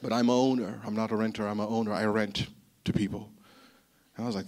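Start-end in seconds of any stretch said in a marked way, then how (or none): 0.0-3.3 lower, smug boasting voice of a proud homeowner; a hint of a self-satisfied rich man. Boasting landlord quoted.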